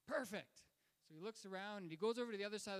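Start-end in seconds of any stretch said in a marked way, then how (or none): 0.1-0.6 higher, louder one-word exclamation; delighted approval. Retold or imitated. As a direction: burst out with eager approval. Acting Einstein's delighted approval.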